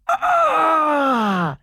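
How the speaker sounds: long high "ah", sliding down; hammed-up cry of pleasure; mock ecstatic moan. Acted moan in sex story.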